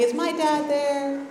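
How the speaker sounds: a lightly voiced little girl, slow and timid; little girl on phone. Little girl calling bars for her dad, lightly voiced timid. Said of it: "I was that little girl that would call the bars".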